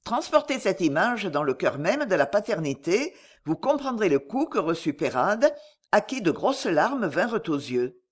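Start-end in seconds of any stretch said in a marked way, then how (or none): none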